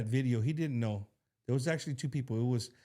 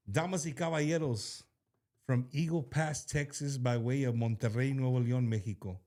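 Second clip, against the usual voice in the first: lucha libre announcer; 5 semitones lower than usual. Acted. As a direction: announce him like a wrestling entrance. Introduces the guest artist.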